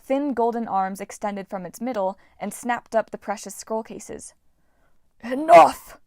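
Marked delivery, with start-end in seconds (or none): 5.2-6.0 loud, high furious shout; yell. A narrator's reading. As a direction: bellow it to stop them. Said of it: "Wolfhead yelled".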